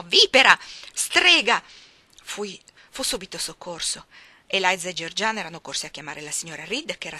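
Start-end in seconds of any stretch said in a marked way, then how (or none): 0.0-1.6 much louder, higher screamed insults; yell of pain. Acted. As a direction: scream the names in pain and rage. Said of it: "con un urlo di dolore egli mi chiamò". (urlo di dolore e di rabbia)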